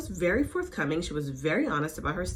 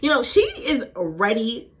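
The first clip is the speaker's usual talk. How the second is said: ordinary delivery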